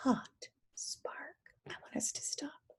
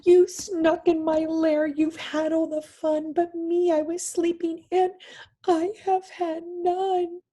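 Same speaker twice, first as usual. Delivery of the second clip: higher, louder sulky bear voice; whiny bear; 6 semitones higher, 6 dB louder than usual. Acted. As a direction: whine it like a hurt big bear. Bear complains to animals.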